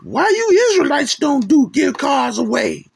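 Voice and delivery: high, mocking whiny question; mock critic asking why no free cars. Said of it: "and people".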